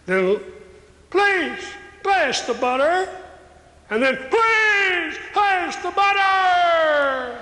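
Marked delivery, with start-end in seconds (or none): 1.1-3.1 pitched higher, pressing the request; higher insistent ask. Retold or imitated. The little voice at the table, asking higher and more insistently. Request repeated, getting louder. 4.2-7.4 high, drawn-out, very slow shout; shouted demand. Retold or imitated. The ignored voice at the table finally shouting for the butter.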